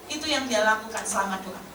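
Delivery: ordinary delivery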